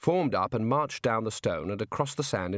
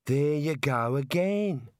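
put-on Australian girl voice; 2 semitones higher, slower than usual. Neelet's lines in the story.

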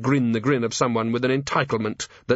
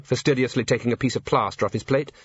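ordinary delivery, nothing marked

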